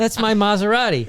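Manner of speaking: high, laughing indignant claim; a greedy stranger claiming the car, laughing; mock outrage.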